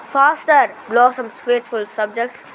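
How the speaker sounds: plain reading voice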